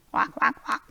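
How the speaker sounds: breathy voiceless quacking; whispered duck noise; duck quacks. Pretend. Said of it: "You gotta work on your bird noises".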